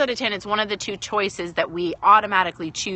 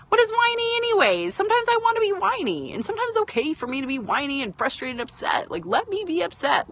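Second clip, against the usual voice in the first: whiny, pitched way up; whiny protest; 9 semitones higher than usual. Pretend. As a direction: plead to be allowed to whine. Arguing for being allowed to whine.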